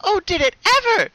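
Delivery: very high, loud squealing joy; very high gleeful squeal; giddy excitement. Acted. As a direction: bounce with delight. Pinkie voiced, joyful.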